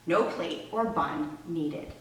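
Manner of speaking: usual speaking voice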